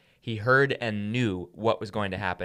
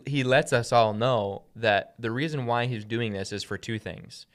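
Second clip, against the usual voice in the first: normal talking voice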